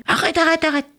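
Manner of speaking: high-pitched, repeated cut-off; urgent interruption. Acted. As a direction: break in on the child's questions. Father cuts off eager son. (interruption pressante, voix aiguë)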